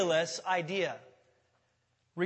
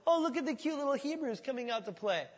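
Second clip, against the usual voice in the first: higher condescending coo; mocking; 6 semitones higher than usual. Philistines mocking the cute little Hebrews, higher coo. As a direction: talk down like to toddlers. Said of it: "they're just mocking them".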